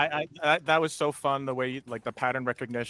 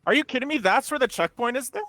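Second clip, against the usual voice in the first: high, loud disbelieving outburst; can't believe it, voice jumps up; disbelief; 13 semitones higher, 4 dB louder than usual. Real, not acted. Shocked at checkpoint placement.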